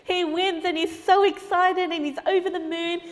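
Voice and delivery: loud, high, joyful telling; excited narration. A narrator's reading. Narrator celebrates the boy's win, loud and excited. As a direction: share the win with delight.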